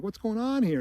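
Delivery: half-laughing, puzzled; a hint of a nervous laugh; lightly laughing. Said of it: "he's kind of laughing".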